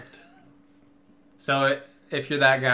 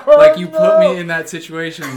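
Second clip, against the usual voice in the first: laughing; 5 semitones higher, 9 dB louder than usual. Real, not acted. Laughing retelling the embarrassment.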